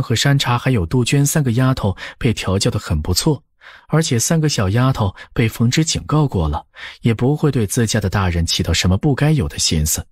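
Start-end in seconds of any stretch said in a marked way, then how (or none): none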